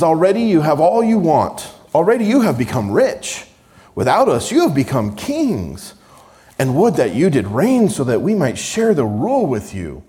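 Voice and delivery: loud, high mocking sarcasm; sarcastic. Paul mocking the boastful Corinthians, loud sarcasm. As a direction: read it dripping with scorn. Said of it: "You hear the sarcasm in my voice".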